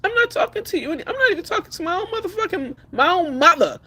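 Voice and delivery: fast and high, fired up; a fast, high burst of heat. Real, not acted. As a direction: rattle it off hot and quick. Fast high heated rant opening.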